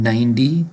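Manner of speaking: "ninety" as "nnnine-dy", long N, rising. Shown as a demonstration. Teacher modelling ninety, D sound. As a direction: hold the N in "ninety", then a D. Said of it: "is pronounced with a D also".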